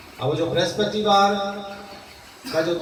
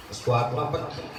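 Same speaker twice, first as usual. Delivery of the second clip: soft answer; 9 semitones lower, 6 dB quieter than usual. Acted. Key refrain dropped low.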